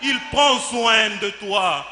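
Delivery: loud, emphatic preaching declamation; fervent proclamation. Acted. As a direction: proclaim it forcefully to the congregation. Proclaims God as orphans' father. (proclamation fervente, d'une voix forte)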